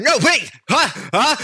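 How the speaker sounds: high, loud calls ending in a scream; frantic calling. Acted. Bird calling out for her friends as she's dragged away, high scream. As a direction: cry out for friends in panic.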